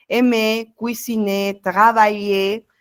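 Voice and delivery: Aimer, cuisiner, travailler with no final r; silent final r. Shown as a demonstration. Teacher models French verbs for the class, final r silent. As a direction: end each verb on a clean é, no r. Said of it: "la R no se pronuncia".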